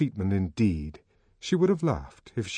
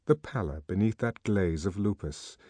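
plain reading voice

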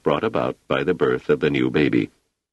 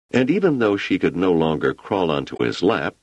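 usual speaking voice

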